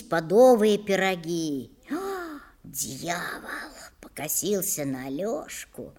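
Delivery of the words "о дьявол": soft, whispered oath; muttered curse. Acted. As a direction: curse under your breath. Muttered curse under his breath.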